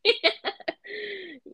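high-pitched cackle; real high laughing fit; helpless laugh. Real, not acted. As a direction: crack up in a long, high laugh. Listener cracks up at story.

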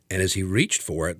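ordinary talk, nothing marked

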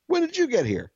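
put-on high, childlike kid's question. Retelling a bathroom story, voicing the child asking a stranger. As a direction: play the small child, chirpy and high. Kid voiced in retold story.

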